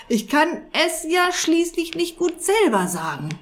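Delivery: high, exasperated moan; exasperated groan. A narrator's reading. Wizard exasperated with the honest cat, groaning. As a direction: roll your eyes and moan it out. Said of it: "drehte die Augen gen Himmel und stöhnte".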